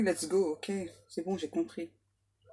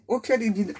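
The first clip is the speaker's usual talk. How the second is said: ordinary talk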